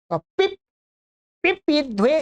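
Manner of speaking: पिप इध्वे with a light break after पिप; light split pronunciation. Shown as a demonstration. Teacher showing students how to split पिप इध्वे, lightly. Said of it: "इस प्रकार से बोलेंगे थोड़ा सा हल्का सा".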